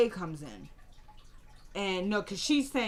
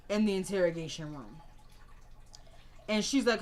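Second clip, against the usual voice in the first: normal talking voice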